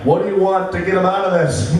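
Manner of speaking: lightly voiced pushy friends, mockingly; mock friends' voice. Friends quizzing him, voiced mockingly.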